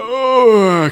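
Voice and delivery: long high groan sliding down; sleepy waking groan. Pretend. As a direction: groan as if roused by an alarm. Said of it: "little bit sleepy".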